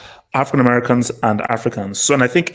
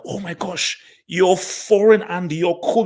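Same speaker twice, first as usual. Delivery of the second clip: higher and slow, fake dazzled; impressed Nigerian listener, mock-awed and higher; mock gushing admiration; 6 semitones higher, slower than usual. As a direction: imitate someone starstruck by a foreigner. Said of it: "is sort of like".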